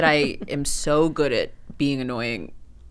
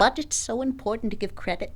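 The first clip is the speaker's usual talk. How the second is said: a hint of the theater teacher voice; lightly voiced, withholding teacher; drama teacher; 6 semitones higher than usual. Shown as a demonstration. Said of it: "That's the voice".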